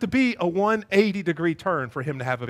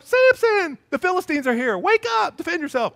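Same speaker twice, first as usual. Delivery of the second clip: high, loud mock alarm; alarmed Delilah; 10 semitones higher, 5 dB louder than usual. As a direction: wake him in a fake panic. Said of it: "each time she wakes him up".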